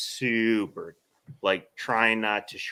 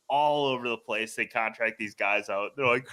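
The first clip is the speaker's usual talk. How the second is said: ordinary talk, nothing marked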